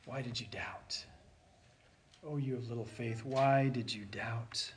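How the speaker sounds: soft, low voice of Jesus; tender, quiet scolding; gentle rebuke. Acted. Jesus gently rebuking Peter for doubting, soft and low.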